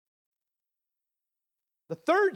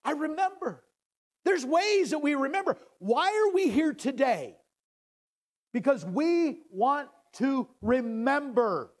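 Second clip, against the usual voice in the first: building preaching; 6 semitones higher than usual. Acted. Preacher rallying the church to remember, building preaching. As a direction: build it toward the climax.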